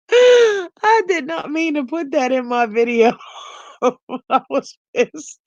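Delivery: high voice breaking into laughter; protesting while helplessly laughing; cracking up. Real, not acted. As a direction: talk through real laughter. Cracking up at chat comments.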